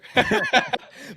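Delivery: a burst of high laughter. Real, not acted. Quarterback laughing at the story of his sister. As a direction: let the laugh ring out.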